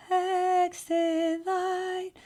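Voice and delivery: high, very slow airy head voice; breathy head voice. Shown as a demonstration. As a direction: sing it thin and breathy like a weak teen. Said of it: "they have kind of a very breathy head voice".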